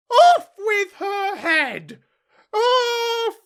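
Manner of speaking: very high, drawn-out shriek of rage; furious scream. A narrator's reading. Queen crimson with fury at Alice, screaming. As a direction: scream it like a wild beast, each word stretched.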